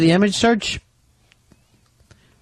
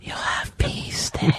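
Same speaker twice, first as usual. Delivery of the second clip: slow, soft demon whisper; slow soothing devil whisper; scary demon voice; 7 dB quieter, much slower than usual. Pretend.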